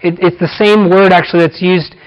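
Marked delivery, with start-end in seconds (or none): none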